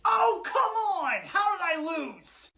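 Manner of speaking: high, drawn-out wail of a sore loser; exasperated whine. Acted. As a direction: protest the defeat in disbelief. Sore loser after the battle.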